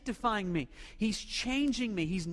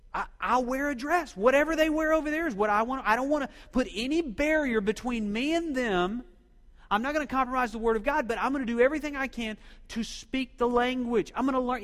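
higher and louder, fervent; passionate resolve; 4 semitones higher, 6 dB louder than usual. Retold or imitated. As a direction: throw yourself into the promise. Friend's passionate resolve, retold.

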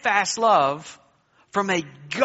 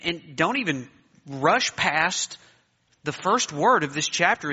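ordinary talk

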